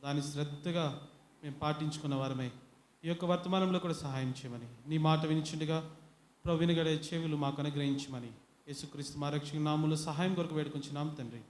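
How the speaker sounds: soft, quiet prayer voice. Acted. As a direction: pray softly to the Father. Closing prayer before sermon. (మెల్లగా ప్రార్థన స్వరం)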